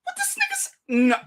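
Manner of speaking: very high squealing what did he say; stunned disbelief. Real, not acted. Shocked reaction continues.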